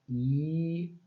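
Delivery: long drawn-out "I"; sustained vowel. Shown as a demonstration. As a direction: hold the "I" as a pure vowel sound. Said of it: "Agora, fale, tenta falar".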